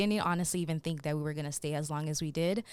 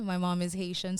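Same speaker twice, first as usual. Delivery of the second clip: normal talking voice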